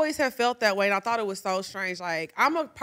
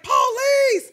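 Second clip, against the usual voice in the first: much higher, loud mock scream for police; panicked yell; 13 semitones higher, 5 dB louder than usual. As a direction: yell like the man on the train meme. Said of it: "He was like".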